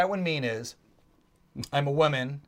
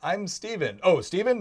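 ordinary talk, nothing marked

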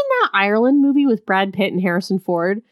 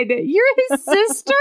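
much higher, rising mock delight; mock thrilled surprise; 10 semitones higher than usual. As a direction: gush like you got the dream toy.

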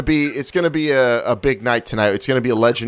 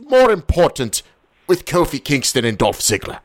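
lightly put-on British gent voice, higher; mock British accent; 7 semitones higher than usual. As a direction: play a pompous British correspondent, a hint of posh. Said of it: "I don't understand your Italian, uh, your accent".

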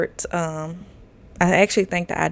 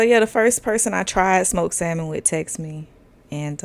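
usual speaking voice

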